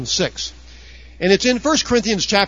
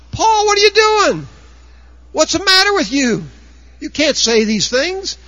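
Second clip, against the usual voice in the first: much higher and louder, hammed-up outrage; outraged conservative; 11 semitones higher, 4 dB louder than usual. Preacher mocking upset conservatives scolding Paul, high and loud. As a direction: shriek it like a shocked church elder. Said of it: "the conservatives get upset".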